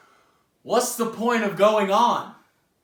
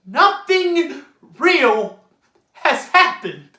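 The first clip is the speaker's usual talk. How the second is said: loud, slow, emphatic verdict; bitter declaration; 6 semitones higher, 6 dB louder, slower than usual. Acted. Character judging another finished play, bitter declaration. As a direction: hammer each word home.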